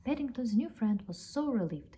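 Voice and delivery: ordinary delivery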